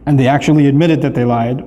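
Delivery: ordinary delivery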